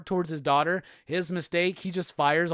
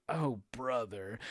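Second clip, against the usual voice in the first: low, slow, quiet groan of dismay; unimpressed aside, dragged out under the breath; weary disdain; 7 semitones lower, 10 dB quieter, slower than usual. Acted. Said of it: "she's just like".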